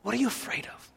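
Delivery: very soft, intimate challenge; quietly probing the listener; hushed question. Real, not acted. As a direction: let the question drop almost to a murmur. Asks again after the shout.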